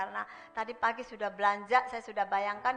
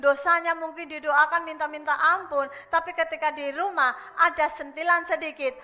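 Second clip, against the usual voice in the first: fiery preaching; 7 semitones higher, 13 dB louder than usual. Acted. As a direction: preach it out hard to the congregation. Preaching at full force. (khotbah berapi-api dengan suara keras dan tinggi)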